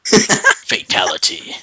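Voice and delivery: slow, drawn-out announcer voice; mock game announcer. Narrator caps the knockout, slow mock announcer. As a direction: stretch it out like a fight game.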